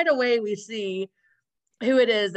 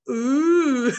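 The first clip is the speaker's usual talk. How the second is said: imitating the gang; 3 semitones higher, 6 dB louder than usual. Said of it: "they all go".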